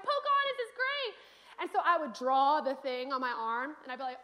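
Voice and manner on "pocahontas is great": put-on high, bright-eyed child raving about Pocahontas; gushing child. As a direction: gush like a thrilled little girl.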